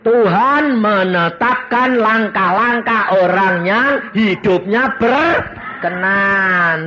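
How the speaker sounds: loud, high, slow proclaimed verse; full-force preaching. Acted. Preacher proclaiming Psalm 37 to the congregation, loud and slow. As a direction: thunder the scripture out to the church.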